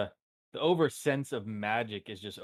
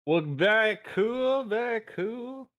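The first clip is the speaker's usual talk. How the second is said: high, slow exaggerated "very cool"; mock awe; 10 semitones higher, 5 dB louder, slower than usual. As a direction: stretch it out in playful over-the-top awe. Player drawls exaggerated approval.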